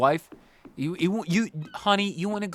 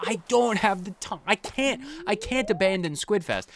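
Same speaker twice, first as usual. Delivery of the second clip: exasperated protest; 8 semitones higher than usual. Retold or imitated. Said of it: "I'm like".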